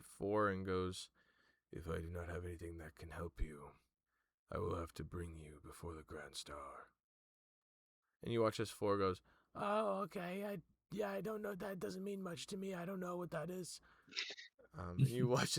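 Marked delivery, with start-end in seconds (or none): 1.7-6.9 softer, grave herald; quiet and solemn, a touch lower; the Herald's voice. Acted. 9.5-13.8 put-on much higher, curious young voice; Four's voice. GM voices Four.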